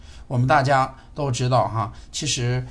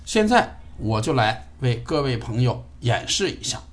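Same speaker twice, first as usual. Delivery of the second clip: normal talking voice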